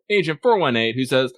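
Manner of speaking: ordinary talk, nothing marked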